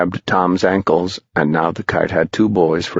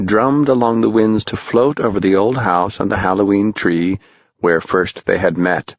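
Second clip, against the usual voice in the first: usual speaking voice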